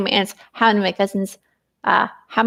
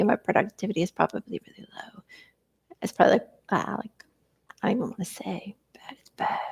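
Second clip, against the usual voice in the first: soft whispered admission; embarrassed whisper; 8 dB quieter than usual. Real, not acted. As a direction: drop to a whisper, ashamed to say it. Embarrassed confession of low productivity.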